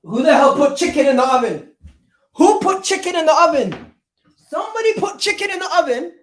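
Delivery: loud, high, exasperated yelling; real shout. Real, not acted. Streamer yelling at his household about the chicken, loud. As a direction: yell across the house at whoever did it.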